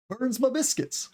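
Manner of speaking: high-pitched Southern "burns ma biscuits"; Southern accent. Acted. Teacher showing ma in the South, Southern accent. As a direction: put on a Southern twang, my as ma. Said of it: "my is sometimes pronounced as "ma" in the South".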